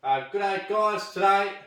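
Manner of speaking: loud, high, hammed-up Aussie hello; big vlog greeting. Host greeting his viewers, loud and high. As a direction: belt out the welcome like a show opener.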